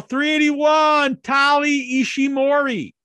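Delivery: mock announcer calling the next name; announcer shout. Hyped list announcing.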